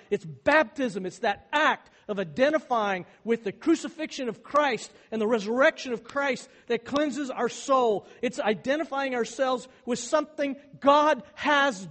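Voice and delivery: loud, rising pulpit delivery; fervent preaching. Acted. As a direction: build the sermon to its peak. Preaching climax on baptism.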